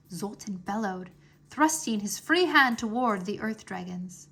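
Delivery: usual speaking voice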